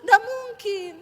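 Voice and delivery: crying out names to God in a pleading wail; a pretend wife weeping over her husband, pleading; mock tearful prayer. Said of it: "tangisi suamimu".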